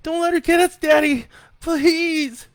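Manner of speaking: put-on very high, loud child's plea; a boy begging his dad to protect him; desperate pleading. A narrator's reading. Frightened boy begs his father to save him, desperate. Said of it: "he pleaded".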